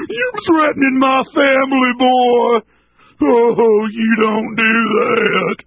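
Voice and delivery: gasp, then high drawn-out menacing voice; hammed-up threatening lawman, pitched high; mock enraged cop. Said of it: "slammed the brakes on and went".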